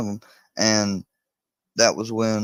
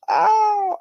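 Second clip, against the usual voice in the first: much higher, louder exaggerated yelp; mock yelp; 7 dB louder than usual. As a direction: yelp ow to make the dog let go. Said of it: "but I'll go".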